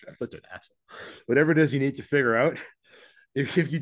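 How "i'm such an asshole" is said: quick, softer muttered aside to himself; a fast self-mocking mutter under the breath. Real, not acted. Laughing self-rebuke mid reading.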